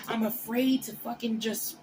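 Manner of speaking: ordinary talk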